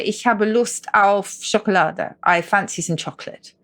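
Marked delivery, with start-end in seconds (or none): 0.0-2.1 slow, careful native German; spoken as a German would say it; German phrase. Shown as a demonstration. Teaching a German example to listeners, in German. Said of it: "So you say".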